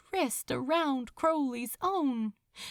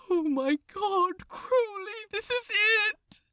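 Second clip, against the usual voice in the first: much higher, crying out in terror; panicked moan; 11 semitones higher, 4 dB louder than usual. Acted. Aziraphale crying out to Crowley as Titanic sinks, terrified. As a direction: wail it as the ship goes down. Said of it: "moaned Aziraphale".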